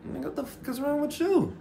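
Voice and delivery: high put-on woman's voice, exasperated; imitating the lady. Lady at the door, mock exasperated high voice. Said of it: "And she was like".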